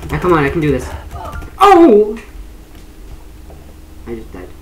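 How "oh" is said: high, loud startled cry; sudden cry. Real, not acted. Dying in the game, startled loud cry. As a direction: jolt at the shock.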